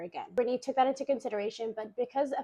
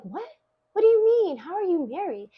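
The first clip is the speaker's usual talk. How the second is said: mock shocked squeal, much higher and louder; shocked what; 8 semitones higher, 8 dB louder than usual. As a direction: burst out in disbelief at the news. Shocked retold reaction.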